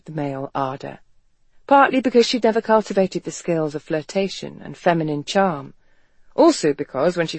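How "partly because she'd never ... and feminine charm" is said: usual speaking voice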